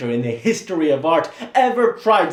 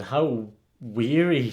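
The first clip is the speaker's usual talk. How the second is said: ordinary delivery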